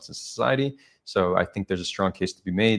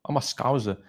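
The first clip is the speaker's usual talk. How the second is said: higher-pitched, lilting Liverpool accent; Scouser accent; 5 semitones higher than usual. Shown as a demonstration. Streamer showing viewers a favourite accent, playful Scouse. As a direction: put on an amateur Scouse accent. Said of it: "The Scouser accent, Liverpool".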